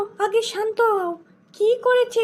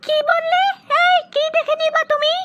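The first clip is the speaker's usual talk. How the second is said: angry wife; 7 semitones higher, 4 dB louder than usual. Acted. Wife challenges husband's threat.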